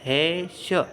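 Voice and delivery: "म, हे, श" loud, each sound held long; leading repeat-after-me. Shown as a demonstration. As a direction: draw out "म, हे, श" for pupils to join in. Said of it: "मेरे साथ बोलो, जैसे देखो".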